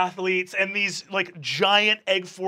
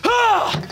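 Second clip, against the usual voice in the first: yelp; 8 dB louder than usual. Acted. GM yelps after voicing Aylwin.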